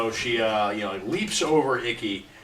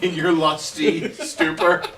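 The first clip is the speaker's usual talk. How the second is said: laughing; 5 semitones higher, 6 dB louder, slower than usual. Real, not acted. Repeats phrase through giggles.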